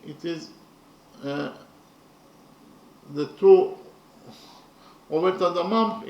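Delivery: normal talking voice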